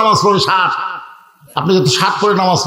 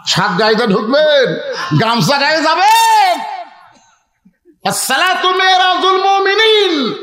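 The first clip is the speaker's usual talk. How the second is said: high, sustained preaching cry; impassioned preaching; 5 semitones higher than usual. Real, not acted. Preacher challenging the congregation about mosque dress and prayer, impassioned chanted preaching.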